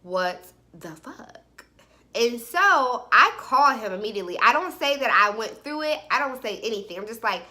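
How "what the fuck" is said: very slow, low and soft; stunned disbelief. Retold or imitated. Storyteller puzzling over his two names, stunned. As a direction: drag each word out in quiet bafflement. Baffled discovering another name.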